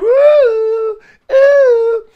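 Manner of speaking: very high loud ooh squeals; excited squeal. Real, not acted. Husband squeals over his wife's show, high excited ooh. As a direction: squeal like a thrilled fan.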